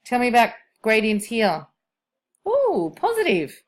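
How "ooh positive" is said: high, slow, delighted ooh on discovery; an eager "ooh" as the answer clicks; surprised ooh. Real, not acted. Teacher spotting the sign to class, pleasantly surprised.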